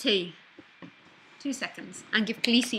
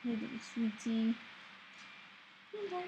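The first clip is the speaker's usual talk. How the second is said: soft pet talk; 10 dB quieter than usual. Real, not acted. Host greeting her little pet, soft and cooing. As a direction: coo softly to a little pet.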